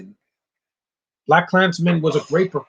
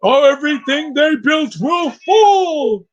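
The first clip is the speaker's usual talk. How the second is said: much higher, loud, slow mock shouted doom; Apocalypse impression; 11 semitones higher, 6 dB louder, slower than usual. Mocking the villain's voice to Josh as a much higher, slow mock shout. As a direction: bellow a hammed-up villain prophecy slowly. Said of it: "he sounds like Apocalypse did".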